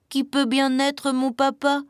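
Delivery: high, puzzled chick voice; small chick musing to himself; wondering little chick. A narrator's reading. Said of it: "se demandait le petit poussin".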